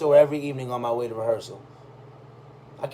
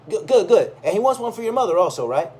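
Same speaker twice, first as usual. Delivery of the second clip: higher, eager, a touch fast; pleased old salesman; 6 semitones higher, 6 dB louder than usual. Retold or imitated. Old furrier pleased at the coming sale, higher and eager. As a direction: rub your hands over a big sale.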